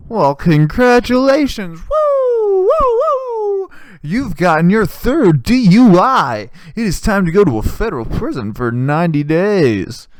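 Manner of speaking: loud, high prize-show announcer with whoops; hammed-up celebratory shout; mock congratulations. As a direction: proclaim it like a jackpot win, stretched out. Acted game-show style DUI prize.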